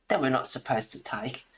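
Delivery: ordinary delivery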